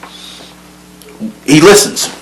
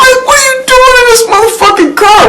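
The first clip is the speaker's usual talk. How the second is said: shocked adults. Retold or imitated. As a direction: squeal it like outraged adults. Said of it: "They're like".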